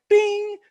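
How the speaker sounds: very high, loud "bing"; ping like a phone alert, high and ringing; imitated notification ding.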